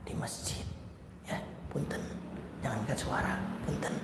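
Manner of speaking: soft polite whisper. Shown as a demonstration. Preacher shows how to quietly hush a shouter, whispering. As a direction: whisper the request so nobody hears.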